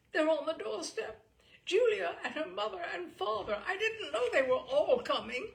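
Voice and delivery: high, loud and breathless with alarm; panicky. Acted. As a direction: blurt it out in a fluster. Pink chap panics at arrivals.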